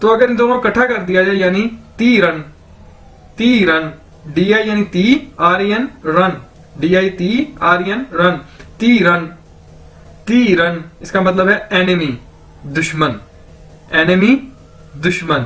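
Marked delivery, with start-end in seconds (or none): 3.4-10.8 ती रन said syllable by syllable, slowly at first; teacher drilling ती रन for enemy; pronunciation drill. Shown as a demonstration. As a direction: break ती रन into ती and रन. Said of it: "इसको कैसे pronounce करते हैं?".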